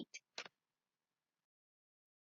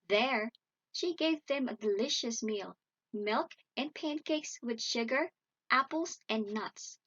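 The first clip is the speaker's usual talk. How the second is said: ordinary delivery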